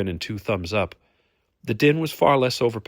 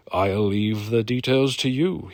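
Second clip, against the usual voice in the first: slow, drawn-out handover; mock deference; slower than usual. A narrator's reading. Brune leaves Hamish to bargain, slow mock deference. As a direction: hand it over with a touch of mockery. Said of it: "he then added sarcastically".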